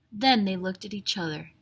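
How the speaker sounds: usual speaking voice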